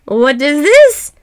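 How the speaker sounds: slow, loud, astonished question; startled cry. A narrator's reading. Ivan seeing the snow maiden move, startled and loud. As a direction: recoil in amazement. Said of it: "cried Ivan".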